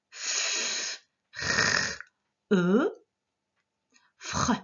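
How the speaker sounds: F, R, E held separately, then blended as FR; sounding out. Shown as a demonstration. Teacher breaking down an ending, sound by sound. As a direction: stretch each sound of F, R, E, then join them. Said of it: "Ensuite, on entendait".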